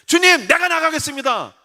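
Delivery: high-pitched, eager declaration to God; eager volunteering. Acted. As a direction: step up boldly in prayer. Acts a volunteer answering God.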